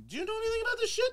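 high, fast, panicky quote; frantic office guy. Retold or imitated. Banker calling a coworker, high fast panicky voice. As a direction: rattle it off like a frazzled exec. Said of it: "he's like".

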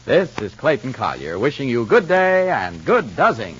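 normal talking voice

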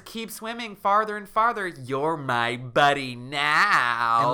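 slow, loud eerie drawn-out line; ghost girl voice. Retold or imitated. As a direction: lure her out like a ghost. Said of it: "And Della says".

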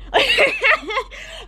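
sudden high burst of laughter; real high laugh. Real, not acted. Vlogger cracking up at her own rant, high laugh. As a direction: crack up at the idea.

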